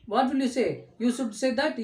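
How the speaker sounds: ordinary talk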